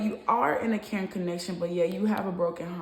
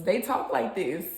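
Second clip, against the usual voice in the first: ordinary talk, nothing marked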